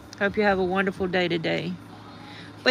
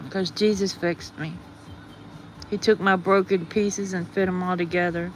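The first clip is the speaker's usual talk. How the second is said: normal talking voice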